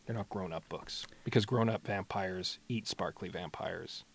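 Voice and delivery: a whispered joke aside. Real, not acted. Host whispering a joke about vampire diets. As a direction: hush it like a secret punchline.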